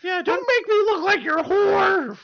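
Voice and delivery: very high, loud squeaky scolding; loud high-pitched puppet complaint; talking hand. Acted. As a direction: shriek it as an outraged tiny hand. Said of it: "It talks to you like".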